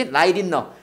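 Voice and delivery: "Light in 너" said "라이린너", with a tapped R for T. Shown as a demonstration. Teacher repeats it for students, T said as R. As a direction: link "Light" to "in 너" with a flapped T. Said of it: "이게 R이야".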